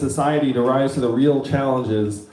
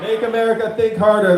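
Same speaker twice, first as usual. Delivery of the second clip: raised, pumped-up rally cry; rally shout; 8 semitones higher, 3 dB louder than usual. Real, not acted. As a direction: fire up the crowd. Rally echo of crowd chant.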